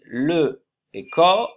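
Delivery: le et co said slowly, syllable by syllable. Shown as a demonstration. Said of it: "les syllabes". (syllabes lentes)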